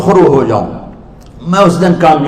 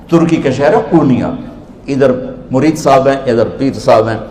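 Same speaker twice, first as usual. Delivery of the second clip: usual speaking voice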